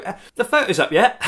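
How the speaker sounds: high, loud nagging mock voice; mock pestering rider. Mocking a rider who nags the photographer for photos, high and loud. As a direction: whine like an impatient rider chasing photos.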